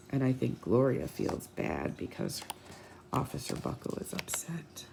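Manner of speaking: hushed whisper; soft storyteller whisper. Acted. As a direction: breathe it like a secret to the kids. Whispered aside in read-aloud.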